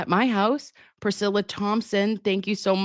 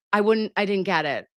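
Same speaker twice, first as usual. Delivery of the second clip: ordinary talk, nothing marked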